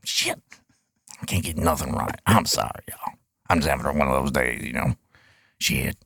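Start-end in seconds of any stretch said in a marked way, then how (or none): 1.2-6.0 deep, quiet grumbling complaint; low grumbling character. Acted. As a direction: mutter low like a fed-up old man.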